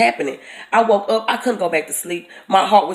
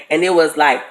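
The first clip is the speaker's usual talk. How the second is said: normal talking voice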